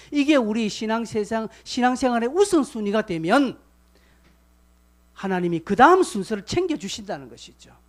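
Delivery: loud, driving sermon delivery; full-force preaching. Acted. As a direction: push it hard like a revival pulpit. (힘차게 외치는 설교)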